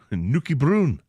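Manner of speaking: slow Scottish Geordie-style Nuki Bruin with Bruin for brown; Scottish accent. Retold or imitated. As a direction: drawl out Nuki Bruin like a Newcastle local. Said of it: "The word brown is pronounced Bruin".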